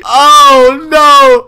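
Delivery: high, loud, drawn-out "oh no"; big shocked exclamation; dismayed outcry. Real, not acted. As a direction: cry out in gleeful alarm at the drama. Reacting to an insult in chat.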